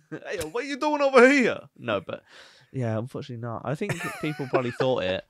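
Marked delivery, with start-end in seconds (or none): 0.1-1.6 loud, high New York yell; hammed-up New York heckle; mock New York accent. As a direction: holler like a cartoon New Yorker. Acting a heckling New Yorker.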